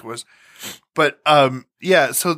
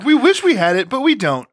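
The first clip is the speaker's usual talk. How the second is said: mock DJ; 7 semitones higher than usual. Mocking the DJ.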